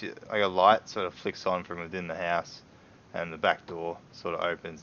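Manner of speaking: ordinary talk, nothing marked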